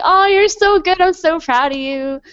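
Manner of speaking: high, cooing praise for a new player; doting praise. Retold or imitated. Praising a beginner like a baby, high doting coo. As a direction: coo at them like a proud parent.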